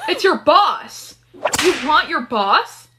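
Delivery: loud, high, drawn-out teasing; gleeful realisation. Acted. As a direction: squeal it slowly, teasing a friend. Friend realises who the crush is.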